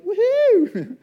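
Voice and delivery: high, loud whoop of delight; excited cheer. Acted. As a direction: whoop it out like a huge celebration. God's delight over something tiny.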